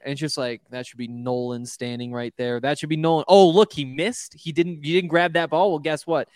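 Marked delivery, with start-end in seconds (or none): none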